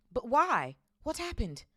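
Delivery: put-on high, urgent boy; urgent question. A narrator's reading. Harry asking Hagrid what happened, urgently. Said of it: "Harry asked urgently".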